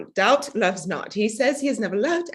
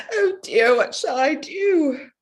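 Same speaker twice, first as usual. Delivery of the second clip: high, loud tearful wail, slow at the end; crying; 6 semitones higher, 3 dB louder, slower than usual. Acted. Bride crying to her father before church, high tearful wail. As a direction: wail it out in tears before the wedding. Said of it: "there, there Jenny is at it now".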